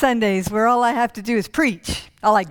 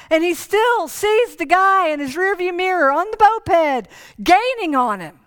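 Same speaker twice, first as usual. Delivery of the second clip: excited chase telling; 7 semitones higher than usual. A narrator's reading. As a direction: build the thrill of the race. Telling the high-speed chase.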